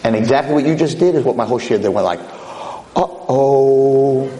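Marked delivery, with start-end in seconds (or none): 3.0-4.3 drawn-out, mock-dreading uh-oh; imitated collective uh-oh. Whole shiur reacting to the kid's question, mock dread. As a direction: stretch it out like a crowd sensing trouble.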